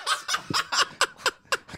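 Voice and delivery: sudden high breathy laugh. Real, not acted. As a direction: burst out laughing. Laughing at the accent joke.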